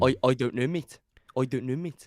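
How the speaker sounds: mock South African vowels; South African accent. Shown as a demonstration. Co-host shows podcaster a South African accent. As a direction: imitate a South African speaker. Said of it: "I don't know what a South African".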